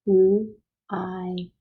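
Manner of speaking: "L-I-N" as three held sounds: lll, long eye, nnn; "L-I-N" sound by sound, not letter names. Shown as a demonstration. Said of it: "It's saying the I sound".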